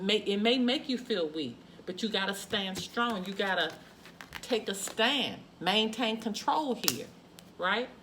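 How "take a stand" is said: ordinary delivery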